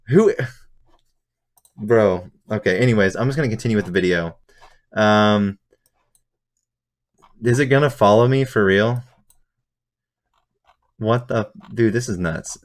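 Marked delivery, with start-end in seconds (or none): none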